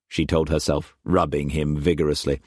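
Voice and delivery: usual speaking voice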